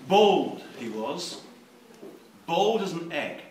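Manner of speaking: measured novel reading; story reading. Acted. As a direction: read it like a dark tale. Reads his novel aloud.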